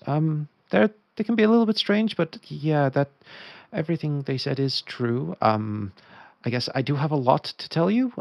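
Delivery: put-on a shrugging, slightly awkward young mage; Solara. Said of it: "Solara sort of shrugs a little bit and goes".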